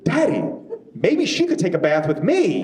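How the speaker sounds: put-on high, eager kid voice; little boy voice. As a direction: pipe up like a hopeful toddler. Son voiced in a story.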